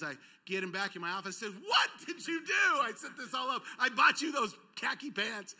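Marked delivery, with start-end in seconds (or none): 1.7-2.8 high, loud outburst of retold anger; angry shout. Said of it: "And I was mad". 2.8-5.5 high-pitched, exasperated retold rant; angry scolding. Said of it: "And I was mad".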